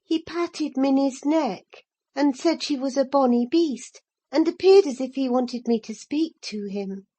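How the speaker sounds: plain reading voice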